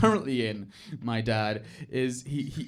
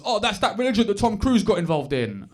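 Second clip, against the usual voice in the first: raised, exclaiming dad voice; higher mock dad voice; disapproving dad; 4 semitones higher, 4 dB louder than usual. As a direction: imitate a dad dismissing it knowingly. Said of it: "he's against sociology because".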